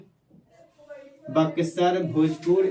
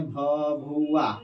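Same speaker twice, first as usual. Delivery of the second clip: teacher drilling Bhabua slowly; slow drawn-out naming; much slower than usual. Shown as a demonstration. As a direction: stretch Bhabua out very slowly, syllable by syllable. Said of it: "जिसको हम लोग भभुआ कहते हैं".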